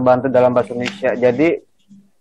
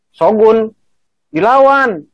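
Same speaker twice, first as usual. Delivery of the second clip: SHOGUN high, pause, DILAWAN higher then falling; kalimat perintah; 7 semitones higher, 4 dB louder, slower than usual. Shown as a demonstration. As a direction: order it, high-pitched DILAWAN. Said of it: "menjadi perintah ya". (nada perintah, DILAWAN tinggi)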